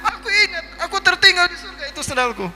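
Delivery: high, loud squabbling back and forth; excited comic banter. Acted. Idris tells the angel his sandal was left in heaven, high excited banter. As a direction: play both sides of the sandal mix-up with amused alarm.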